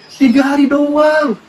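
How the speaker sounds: high, long-drawn exclamation; incredulous exclamation. Acted. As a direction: stretch it out high in amazement. Exclaims at Mary's short separation.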